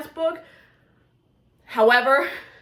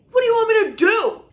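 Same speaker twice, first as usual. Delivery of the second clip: exasperated quote; 10 semitones higher, 5 dB louder, faster than usual. Retold or imitated. Quoting a character, exasperated.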